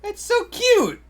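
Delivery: squealing high, it's so cute; gushing. Real, not acted. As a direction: gush like you just saw a puppy. Gushing over something cute.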